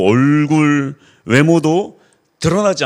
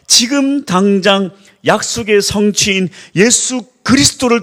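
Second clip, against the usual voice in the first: rising, louder sermon cry; full-force preaching; 7 semitones higher, 4 dB louder than usual. Acted. Pastor urging the congregation toward faith, fervent preaching. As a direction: preach it higher and higher to the flock.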